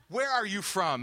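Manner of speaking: loud, high, pressing question; imitated exasperated demand; retold insistent question. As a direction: push the question hard, acting it out.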